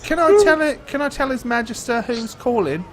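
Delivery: put-on high, loud squeaky kid; squeaky servant boy. GM voices the doorkeeper kid.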